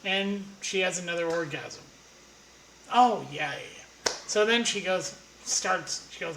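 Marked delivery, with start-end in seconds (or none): 2.9-4.1 mock moan. Mimics her orgasm.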